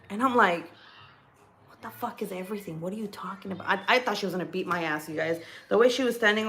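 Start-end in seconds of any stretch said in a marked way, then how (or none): 1.7-3.7 hushed, retold bewilderment; very soft, quick incredulous reply; stunned disbelief. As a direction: say it under your voice, baffled.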